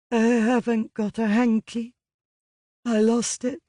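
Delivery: put-on muffled, teary young woman's voice. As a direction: speak through tears, muffled. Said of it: "she said in a muffled voice".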